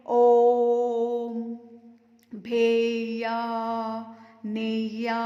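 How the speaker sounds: ओम, भेया, नेया dragged out very slowly; prolonged vowels. Shown as a demonstration. Teacher to students, showing prolonged vowels ओम, भेया, नेया drawn out slowly. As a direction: hold ओम, भेया, नेया three times as long. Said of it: "तीन गुना".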